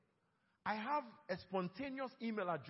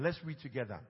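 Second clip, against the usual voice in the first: ordinary talk, nothing marked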